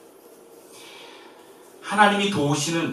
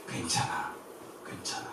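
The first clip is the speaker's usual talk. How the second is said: gentle reassurance; 10 semitones lower, 11 dB quieter than usual. Acted. Voicing comfort.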